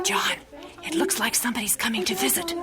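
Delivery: quick hushed whisper; fast whisper. Acted. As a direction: tell him secretly, under your breath. Whispering about visitors.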